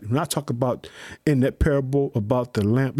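ordinary delivery

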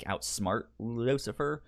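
ordinary delivery

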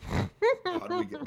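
high, loud and slow; high dramatic. Real, not acted. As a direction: stretch it up high. Dramatic question rising loud.

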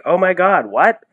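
usual speaking voice

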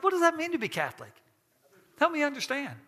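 eager, bright, quick question; open, interested asking, wanting to learn; genuinely curious. Shown as a demonstration. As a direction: ask like you really want to know. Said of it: "It's like".

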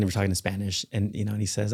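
normal talking voice

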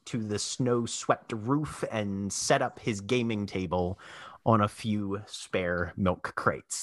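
ordinary talk

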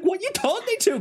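very high indignant whine; exasperated. Retold or imitated. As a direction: fire it back indignantly. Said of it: "they're so exasperated".

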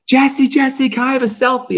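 put-on high-pitched eager child calling out; excited little kid. Young fan to team owner, excited high kid voice.

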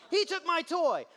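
put-on high whiny child's complaint; squeaky tattletale voice; whiny kid. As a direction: play the upset kid telling on his sibling.

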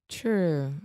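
True with rounded lips, like a CH; lips pursed. Shown as a demonstration. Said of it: "round your lips and say true".

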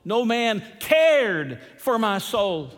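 slow, with "cared" lifted and stretched; stress on CARED. Shown as a demonstration. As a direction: lean hard on "cared", then fall away. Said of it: "Now we emphasize a different word".

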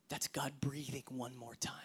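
low reverent whisper; awed whisper. Acted. Preacher marveling at God's breath, whispering. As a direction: hush it like a holy moment. Hushed awe after gasp.

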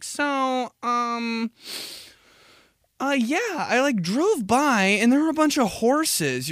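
high rambling mom, slow and meandering. Retold or imitated. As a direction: play a chatty mom going off on a tangent. Voicing mom on the phone.

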